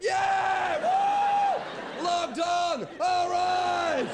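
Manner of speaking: mock whooping office crowd, high and loud; office cheering. Mock office crowd cheering a colleague for logging on. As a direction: cheer like the whole office is celebrating. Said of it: "the whole office just went".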